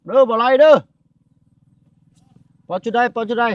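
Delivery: loud, high call from a distance. Real, not acted. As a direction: shout it out to someone far away. Calling out to people far off.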